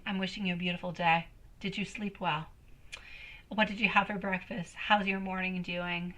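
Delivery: soft, low and sincere; tender, heartfelt. Real, not acted. Reader wishing love for the whole soul family, tender and soft. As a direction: wish it gently, from the bottom of the heart. Heartfelt wish for everyone listening.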